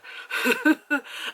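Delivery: short high nervous laugh; a nervous giggle breaking out. Real, not acted. Laugh after horror story.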